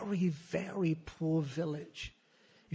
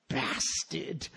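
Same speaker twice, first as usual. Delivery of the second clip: muttered curse; 4 dB quieter than usual. Real, not acted. Muttered insult at father.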